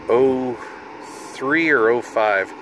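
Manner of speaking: ordinary talk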